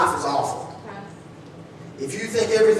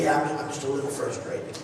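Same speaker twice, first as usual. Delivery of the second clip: shrugging it off; 6 semitones lower, 9 dB quieter than usual. Retold or imitated. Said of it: "And you say".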